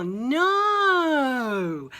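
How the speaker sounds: plain reading voice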